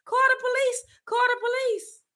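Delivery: very high, whiny mock cry for help; mock scream for police. Man pinned in a headlock begging for police, mock high squeal. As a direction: squeal it like a grown man scared stiff. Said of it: "he was crying and screaming for the police".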